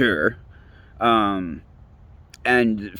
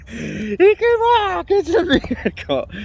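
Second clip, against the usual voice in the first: much higher and slow, hammed-up preacher's cry of wonder; mock miracle proclamation; slower than usual. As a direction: stretch it out like a revival preacher announcing a miracle.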